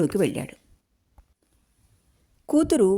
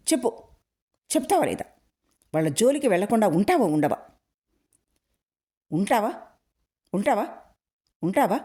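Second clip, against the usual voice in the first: angry demand; 3 semitones higher, faster than usual. A narrator's reading. Father beating, demanding answer.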